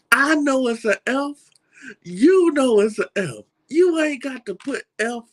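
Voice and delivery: high, loud, indignant "I know it's an F"; exasperated. Retold or imitated. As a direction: protest at the teacher, pitched high and loud. Retold frustration at big F.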